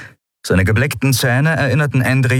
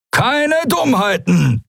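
high, drawn-out pirate warning; threatening; slower than usual. A narrator's reading. Halbhorn repeating his warning to captives, threatening. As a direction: warn them slowly in a raised voice. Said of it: "wiederholte Halbhorn".

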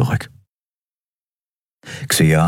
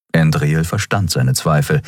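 ordinary delivery, nothing marked

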